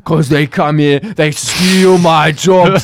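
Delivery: loud, high, drawn-out rant; hammed-up bigot's complaint; imitating ranting lady. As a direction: belligerent caricature with slow, stretched words. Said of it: "And she's like".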